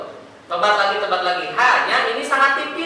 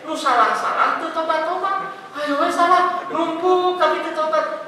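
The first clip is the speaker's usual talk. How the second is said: higher, half-sung mock of a careless sinner; mocking sing-song; 6 semitones higher, slower than usual. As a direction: taunt them in a lilting tease.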